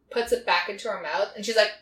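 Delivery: normal talking voice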